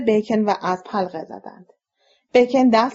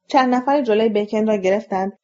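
usual speaking voice

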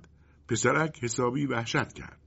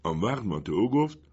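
usual speaking voice

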